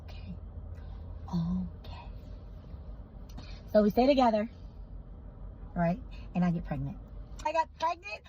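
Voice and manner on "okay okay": soft breathy whisper of acceptance; whispered okay. Real, not acted. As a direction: let it out in a whisper, giving in. Quietly resigning herself.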